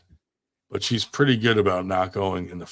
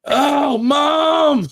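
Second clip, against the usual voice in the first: put-on much higher, louder child's wail for mom; shrieking little girl; 6 dB louder than usual. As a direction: squeal like a kid who just got pranked. Daughter shrieks for mom after prank.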